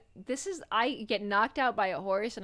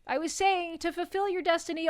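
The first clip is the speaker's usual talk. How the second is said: overacted death scene; 9 semitones higher, 3 dB louder than usual. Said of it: "continues coughing".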